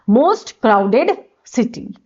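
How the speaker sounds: drawn-out, word by word; very slow dictation. Shown as a demonstration. Teacher dictating the phrase for students, very slow. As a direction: let each word sink in slowly. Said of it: "one of the most लगाओगे।".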